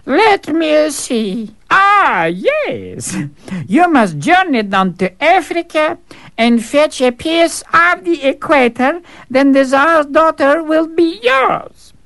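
high, slow mock-reading voice; scheming servant. Servant pretending to read the rules to the fool, slow and high. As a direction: savour the impossible task. Servant sets second task.

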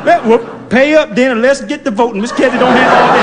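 put-on high, loud, giggling old lady; laughing old woman. Old woman laughing as she makes the narrator pay. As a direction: cackle while passing the bill.

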